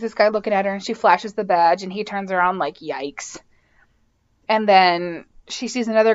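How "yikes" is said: mock yikes. Guy reacts to Jane's badge.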